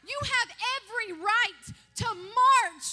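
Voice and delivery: high, loud preaching that builds to shouting; full-force preaching. Acted. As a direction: preach it hard and rousing.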